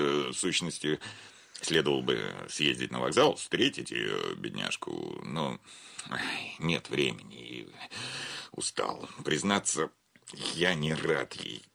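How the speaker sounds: fading into a soft tired mutter; weary aside. Acted. Banker grumbles to himself about meeting his wife, fading. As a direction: let it trail away, worn out.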